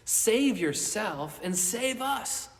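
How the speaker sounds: loud, high, sneering challenge; mocking taunt. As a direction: taunt him from the cross. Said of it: "mocks him, insults him".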